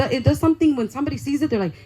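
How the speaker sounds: ordinary talk, nothing marked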